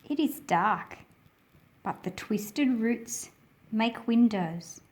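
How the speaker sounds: ordinary delivery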